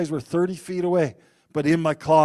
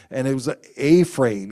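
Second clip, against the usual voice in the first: usual speaking voice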